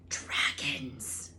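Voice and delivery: hushed whisper. A narrator's reading. Ron revealing Hagrid's books to friends, whispered. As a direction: breathe it like a secret. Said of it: "he whispered".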